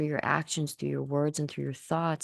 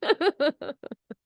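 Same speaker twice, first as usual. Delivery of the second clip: high-pitched delighted giggle; a bright, high little laugh; 13 semitones higher, 4 dB louder than usual. Acted.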